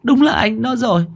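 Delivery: high mother's voice, tearful confession; grieving mother. Acted. Narrator voices Bà Thủy.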